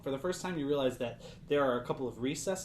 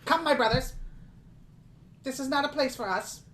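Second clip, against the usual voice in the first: much higher, huffy little creature voice; small indignant creature; 11 semitones higher than usual. Acted. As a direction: sniff and retreat with wounded pride. Darrow leader retreats.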